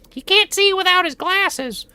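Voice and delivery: very high, slow cartoonish whine; squeaky whiny voice. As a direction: whine it way up high, dragging each word. Mock voice about himself.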